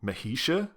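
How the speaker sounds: "Mehisha" with a high questioning rise and a "sh" sound; unsure pronunciation attempt. Shown as a demonstration. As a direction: offer "Mehisha" as a tentative guess, voice lifting. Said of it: "not sure how that's meant to be pronounced".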